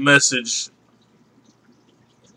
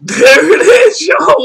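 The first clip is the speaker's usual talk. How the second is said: high, loud excited shout; hyped reveal; 13 semitones higher, 5 dB louder, much slower than usual. Real, not acted. Excitedly shouting the reveal to his viewers after playing the voicemail. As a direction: yell it out to the viewers like a big reveal.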